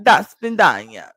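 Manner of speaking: slow, loud Russian-accented farewell; put-on Russian accent. Shown as a demonstration. Showing how to troll agents with Russian, put-on Russian accent. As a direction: throw on a heavy Russian accent. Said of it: "Just with throwing that accent".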